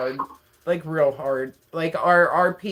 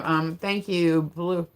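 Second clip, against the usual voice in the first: normal talking voice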